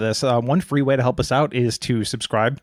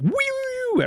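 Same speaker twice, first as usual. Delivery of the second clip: very high, drawn-out "wheee"; high-pitched imitation of giddy spinning; spinning-top whee; slower than usual. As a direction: squeal like someone whirling round. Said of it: "you're spinning like a top".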